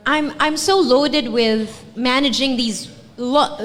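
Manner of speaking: usual speaking voice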